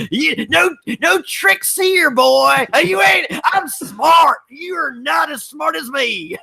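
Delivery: mocking cocky suspect. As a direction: shout it up high like a smug know-it-all. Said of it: "He says, like".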